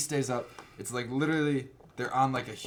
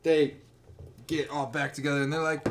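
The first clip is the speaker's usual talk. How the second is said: ordinary delivery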